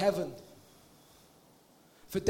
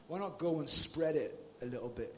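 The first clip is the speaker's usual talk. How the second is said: ordinary talk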